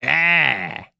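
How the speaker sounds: broad mock Aussie bogan; Australian accent. Host playing a bogan bragging about his girl in a broad Aussie accent. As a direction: brag like a proud bogan. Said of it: "That's Art the Australian".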